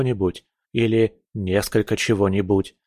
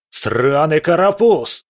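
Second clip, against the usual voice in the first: loud slow angry yell; shout; 6 semitones higher, 5 dB louder, slower than usual. A narrator's reading. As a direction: yell it from above. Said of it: "Крик раздался где-то надо мной".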